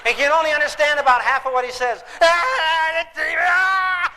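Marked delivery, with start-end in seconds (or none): 2.2-4.1 high strangled yell of fury; dad's rage yell. Retold or imitated. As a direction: yell like a father losing it. Enraged dad yelling.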